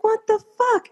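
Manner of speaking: very high, unraised mock disbelief; retold shock. Wife to husband's laughing brother, high retold shock.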